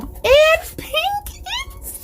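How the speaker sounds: very high, slow, gushing squeal; delighted squeal. Real, not acted. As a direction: stretch it out in a thrilled squeak. Gushing over a new mug.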